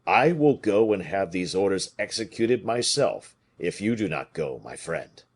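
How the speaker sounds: ordinary delivery, nothing marked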